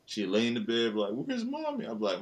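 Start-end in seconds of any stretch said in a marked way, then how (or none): 1.1-1.8 put-on high little-kid voice asking for mommy; toddler voice. Dad voices his daughter asking for mommy, high childish voice. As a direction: pitch up like a small child in bed.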